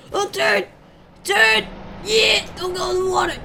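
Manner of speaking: high, loud frantic yells; frantic yelling. Real, not acted. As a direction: yell at the car to turn. Yelling at car to turn.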